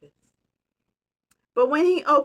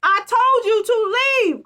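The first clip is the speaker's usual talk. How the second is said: much higher and louder; bear crying out; 12 semitones higher, 8 dB louder than usual. Acted. Annoyed bear shouting at the mouse to leave. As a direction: yell it at the intruder. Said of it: "cried Bear".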